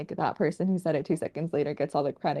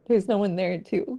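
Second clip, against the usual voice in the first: normal talking voice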